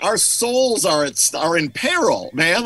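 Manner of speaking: high, drawn-out hammed-up alarm; mock dread. Bandmates fearing the singer on the stairs, mock dread. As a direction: overplay the doom, half amused.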